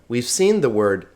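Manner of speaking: normal talking voice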